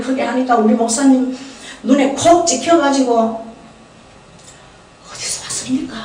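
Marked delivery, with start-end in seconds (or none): 5.1-5.9 a hushed, whispered pastor's question. Retold or imitated. Retelling the pastor quietly asking where she came from, whispered.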